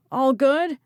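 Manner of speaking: high, loud yelled check. Acted. Archer yelling to Ridley across the road. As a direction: yell it over the storm. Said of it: "he yelled".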